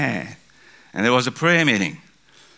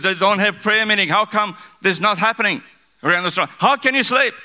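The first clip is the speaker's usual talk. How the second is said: high-pitched, insistent scolding; captain's shout; 10 semitones higher than usual. Retold or imitated. Captain of our ship today challenging the church, shouting. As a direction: fire off indignant questions as the captain. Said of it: "He shouted".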